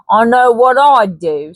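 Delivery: light Cockney trader voice; Cockney Hanson. Acted. Hanson flatters Baines, light Cockney persuasion.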